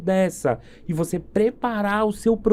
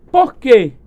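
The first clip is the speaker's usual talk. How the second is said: "Por quê?" shot out louder, like a boss demanding answers; mock demanding boss; 5 semitones higher, 9 dB louder than usual. Boss to the sales team, loud mock demand. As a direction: demand the reason, loudly.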